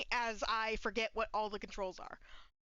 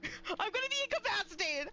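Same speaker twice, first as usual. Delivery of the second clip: laughing, half crying, higher; nervous laugh-cry; 6 semitones higher than usual. Real, not acted. Scared streamer laugh-cries at the horror game. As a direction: giggle through the panic.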